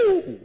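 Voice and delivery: a loud, high "Woo!" of shock; mock crowd gasp. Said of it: "People will say".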